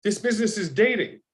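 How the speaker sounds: high-pitched dawning insight; sudden realisation. Retold or imitated. Actor works out the business on his way home, sudden realisation. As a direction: retell the moment it clicks, voice lifted. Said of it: "And then it hits me".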